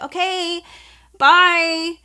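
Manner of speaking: high, hesitant, drawn-out farewell; confused goodbye. Retold or imitated. Store girl sees off the strangely silent group, imitated confused goodbye. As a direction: imitate an awkward, puzzled sign-off. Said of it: "she was genuinely confused".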